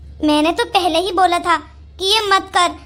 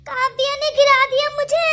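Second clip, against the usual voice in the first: hammed-up crying complaint; wailing accusation; 4 semitones higher than usual. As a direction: wail it like a hurt victim. Fallen woman wailing blame.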